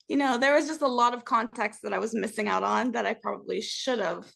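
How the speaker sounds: ordinary talk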